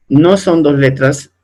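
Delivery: normal talking voice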